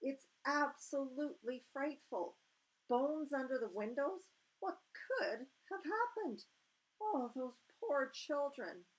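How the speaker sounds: put-on a queen grieving for the poor children; horrified Queen. A narrator's reading.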